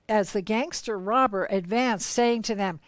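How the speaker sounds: ordinary delivery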